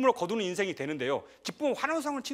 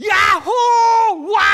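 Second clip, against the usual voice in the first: high, long full-voiced whoop breaking into a laugh; demonstrating a jubilant cheer at full volume; shout of joy; 14 semitones higher, 17 dB louder, much slower than usual. Shown as a demonstration. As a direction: holler "yahoo" as if overjoyed. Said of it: "소리를 지르는 기쁨".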